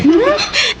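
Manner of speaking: high giggling laugh at a friend's joke; a quick high-pitched giggle; bright laugh. Acted. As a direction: laugh it off, amused and flattered. Friends joking at dinner table.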